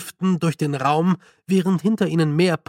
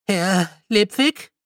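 higher, timid question; young Stanley, nervous, higher; 4 semitones higher, slower than usual. A narrator's reading. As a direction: ask it nervously from the door. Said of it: "kam eine nervöse Stimme".

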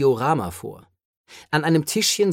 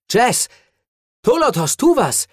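hoch und laut um Hilfe rufen; drängend, voller Sorge rufen; panischer Ruf; 8 semitones higher, 6 dB louder than usual. A narrator's reading. Rios ruft panisch um Hilfe. (Rios ruft den Haluter in Panik um Hilfe, laut und hoch)

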